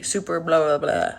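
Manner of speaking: ordinary delivery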